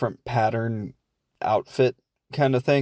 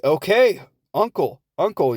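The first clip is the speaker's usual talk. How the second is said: higher-pitched, lightly acted giving in; retold surrender, pitched up; mock surrender; 6 semitones higher, 4 dB louder than usual. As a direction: play a mom crying uncle in a scuffle. Mom gives in while wrestling kid.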